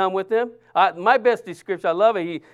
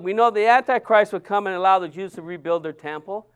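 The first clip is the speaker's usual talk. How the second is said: ordinary talk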